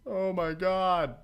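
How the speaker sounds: real wonder, drawn out; awed exclamation. Real, not acted. Amazed at vertex count.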